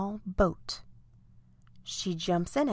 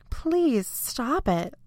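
higher, slow plea; begging; 6 semitones higher, slower than usual. A narrator's reading. Lucy to the blowing cloud, begging. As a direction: plead with drawn-out words. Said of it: "Lucy begs".

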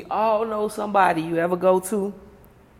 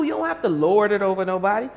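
ordinary talk

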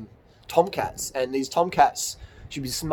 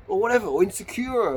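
normal talking voice

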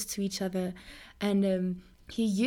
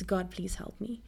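low-key, much softer retold prayer; hushed plea; 3 semitones lower, 7 dB quieter than usual. Preacher recalling her prayer from a dark place, hushed plea. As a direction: drop to a small, quiet plea.

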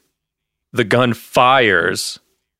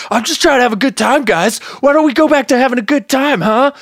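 mock manic gunman; 12 semitones higher, faster than usual. Imitating Donnie causing a scene.

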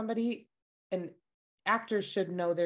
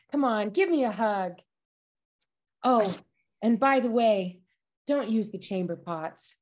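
Jennifer Coolidge impression; 4 semitones higher, 3 dB louder than usual. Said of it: "Jennifer Coolidge in Austin Land".

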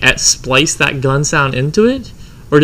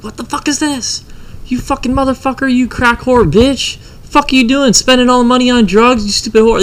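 imitating outraged wife; 9 semitones higher than usual. Cheated-on wife catches husband in bed, imitated outrage. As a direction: act out a furious wife, voice pitched way up.

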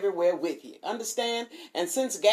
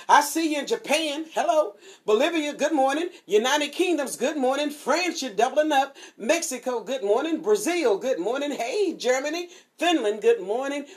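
higher, bright roll call of countries; excited greetings; 8 semitones higher, 4 dB louder than usual. Real, not acted. Radio host greeting listeners abroad, excited and high. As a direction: beam out good mornings one by one.

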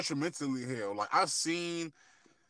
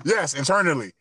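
relieved, loud; 3 semitones higher, 9 dB louder than usual. Real, not acted. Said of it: "say the word for me".

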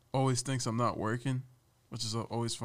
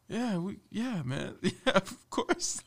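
awkward laughing agreement; 8 semitones higher, much slower than usual. Retold or imitated. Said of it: "I'm like".

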